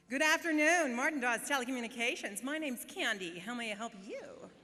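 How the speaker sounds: loud, high, over-the-top American receptionist; hammed-up American accent. Call-centre worker turned Candy greeting customers, exaggerated American accent. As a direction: belt out the greeting in a brash fake American accent. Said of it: "you're kind of talking in this American accent".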